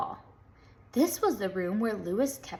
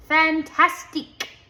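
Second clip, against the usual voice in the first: loud, high French "Fantastique"; French-style exclamation; 7 semitones higher, 7 dB louder than usual. A narrator's reading. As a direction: show off with a French flourish. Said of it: "said Terrence".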